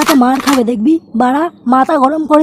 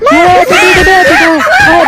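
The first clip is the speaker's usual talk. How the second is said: very high shouted call; 11 semitones higher than usual. Acted. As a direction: call out to someone far away. Yelling for someone far.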